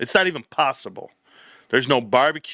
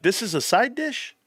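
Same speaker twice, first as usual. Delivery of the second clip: disbelief; 9 semitones higher than usual. Retold or imitated. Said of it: "And I'm like".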